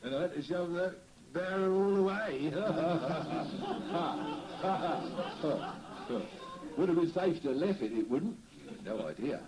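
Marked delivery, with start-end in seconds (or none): 2.6-6.7 long rolling laugh, higher. Real, not acted. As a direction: laugh it off heartily. Laughs about the thieving street.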